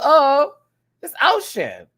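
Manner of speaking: high, loud delighted discovery; light up as you recognize it; excited recognition. Retold or imitated. Hearing [name] in the track, excited high retold cry.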